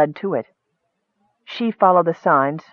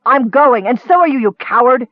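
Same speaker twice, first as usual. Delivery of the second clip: loud, raised, scornful challenge; angry defiance; 5 semitones higher, 5 dB louder than usual. A narrator's reading. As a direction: hurl it at the one holding you back. She strikes him and defies him.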